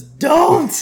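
sudden high, very loud cry; acted-out high-pitched shout; mock shout. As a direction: yell it as a sharp retold warning. Retelling a character's abrupt cry.

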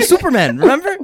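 high, loud mock mom voice; exaggerated high-pitched mom impression; imitated mom. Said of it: "your mom's like".

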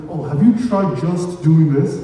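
patronizing tip from a stranger; mock helpful suggestion. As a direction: play the smug helper. Mocking backseat drivers' advice.

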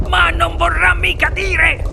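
high, panicky grandfather; aghast, high-voiced protest; alarmed stammer. A narrator's reading. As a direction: falter in high-pitched dread. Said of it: "balbettò nonno Joe".

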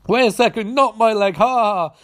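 high mock-triumphant taunt; gloating villain. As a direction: reveal the trick with a fake villain laugh. Villain drops the pretence, gloats.

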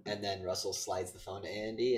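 usual speaking voice

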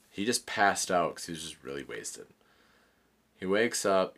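usual speaking voice

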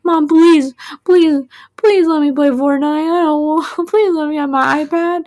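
high, loud mock-crying plea; whining seven-year-old. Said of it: "sounds like my seven-year-old that just got in trouble".

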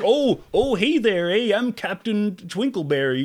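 mock Canadian accent. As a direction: parody a goofy Canadian police officer. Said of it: "a guy being like".

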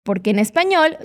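ordinary delivery

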